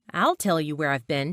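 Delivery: usual speaking voice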